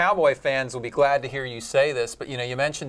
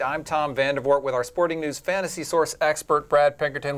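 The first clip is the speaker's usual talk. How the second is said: higher, punchy sportscast intro; big, energetic studio welcome; show-opening announcer; 5 semitones higher than usual. Acted. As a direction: kick off the show with a lifted broadcast voice. Host opens the show.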